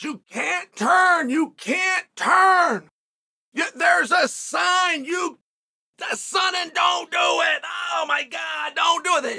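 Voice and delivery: high, slow, shouted warnings from the other car; retold yelling. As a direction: yell the warning over and over, slowly and urgently. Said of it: "They're yelling this".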